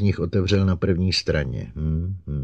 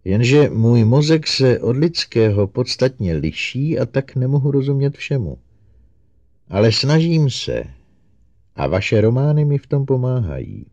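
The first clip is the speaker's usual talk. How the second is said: higher, louder, emphatic robot voice; eager robot Herbie; 6 semitones higher, 5 dB louder than usual. A narrator's reading. Robot admits to the doctor it struggles to understand, eager and raised. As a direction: speak up earnestly as a robot explaining itself.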